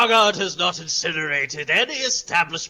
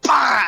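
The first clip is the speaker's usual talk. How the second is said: short loud mouthful bah; angry bah; 9 semitones higher, 7 dB louder than usual. Acted. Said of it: "and he goes".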